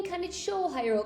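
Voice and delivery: ordinary delivery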